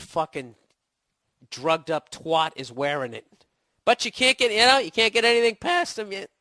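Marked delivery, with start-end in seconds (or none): 3.8-6.3 higher, louder line that cracks; voice cracking. Real, not acted. Comedian with a cold straining until his voice cracks. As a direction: push it until the voice breaks. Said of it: "My voice just cracked right there".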